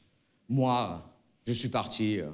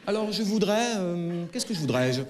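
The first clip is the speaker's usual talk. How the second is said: higher, put-on neutral Parisian voice; without accent; 6 semitones higher than usual. Shown as a demonstration. Customer in a bakery speaking without accent. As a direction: try hard to erase the Marseille accent. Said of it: "je rentre dans une boulangerie et j'ai pas d'accent".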